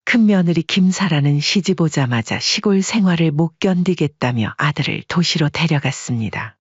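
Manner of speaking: ordinary talk, nothing marked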